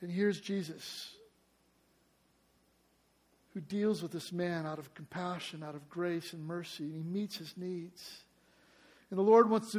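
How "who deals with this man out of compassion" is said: ordinary delivery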